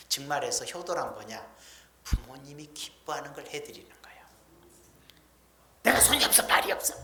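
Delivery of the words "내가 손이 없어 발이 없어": high, loud petulant whine; mock complaining child. Preacher mimics a child refusing to help parents, high petulant whine. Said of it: "그러지 말고".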